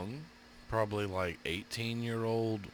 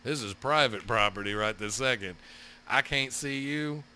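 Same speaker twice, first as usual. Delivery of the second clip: normal talking voice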